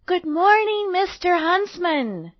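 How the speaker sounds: put-on high, loud girlish greeting; cheery little girl. A narrator's reading. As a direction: call a bright hello as a little girl.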